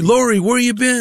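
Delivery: high-pitched mock questioning; stoner friends. Doper friends question him.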